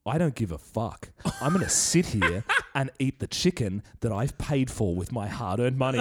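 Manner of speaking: low, soft stubborn grumble; defiant bloke voice. Retold or imitated. Bloke eating chicken defies the cops, low stubborn grumble.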